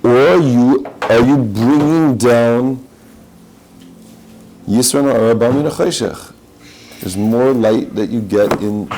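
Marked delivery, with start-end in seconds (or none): none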